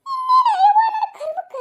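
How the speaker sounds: high, loud wailing lament with a laugh; hammed-up wail of woe; mock wail. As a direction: cry out at her fate, half laughing. Mother-in-law wails at her fate.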